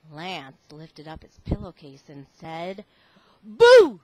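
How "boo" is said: sudden loud shout of boo. Acted. Lance lifts his pillowcase at the big man, loud boo. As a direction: jump out and scare him.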